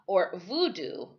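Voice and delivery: usual speaking voice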